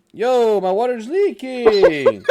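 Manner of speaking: high, loud, drawn-out cry of surprise; alarmed outcry. Real, not acted. Player suddenly finds his water leaking, alarmed outcry. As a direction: stretch it out in laughing alarm.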